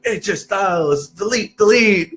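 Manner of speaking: high-pitched wrestling catchphrases; little boy playing wrestler. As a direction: call out like a small wrestling fan. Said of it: "hear him, he's just like".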